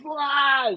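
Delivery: high, loud mock scream of pain; short hammed-up cry, high and loud. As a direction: yelp like the bear got you. Victim attacked by bear.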